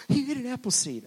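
imitated scandalized gasp, then high, loud alarm; shocked gasp. Preacher mimics a congregation seeing him eat a seed, shocked. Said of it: "everybody gasped".

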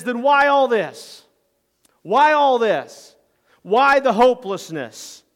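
loud, high, drawn-out demand; frustrated anger. Acted. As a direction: challenge the group in exasperation. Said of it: "It's frustration with the group".

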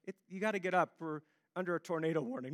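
soft and offhand; pretend-calm warning; casual. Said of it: "I woke her up in a very casual way".

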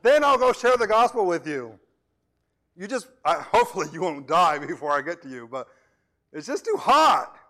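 a hammed-up minister dodging evangelism; lazy minister. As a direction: make excuses in a put-on comfortable voice. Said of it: "that says".

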